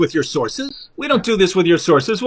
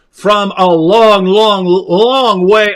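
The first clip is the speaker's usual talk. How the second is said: slow, exaggerated "long, long, long"; drawn-out storytelling; much slower than usual. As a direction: stretch every "long" out slowly. Storyteller stretching the traveler's long distance.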